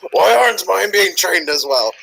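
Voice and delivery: high, slow put-on grumble; mock complaint. Player imagining a jealous complaint about pups, high mock whine. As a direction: wail it in mock bafflement. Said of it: "It's like".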